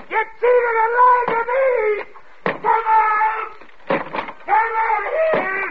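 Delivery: very high, drawn-out screams; screaming rage. Acted. Husband raging at wife to come out, screaming. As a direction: shriek accusations, stretching the words.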